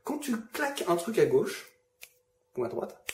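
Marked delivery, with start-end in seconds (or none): none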